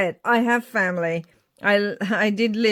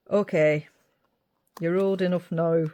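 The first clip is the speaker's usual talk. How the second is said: put-on northern accent. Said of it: "doing it in a northern accent".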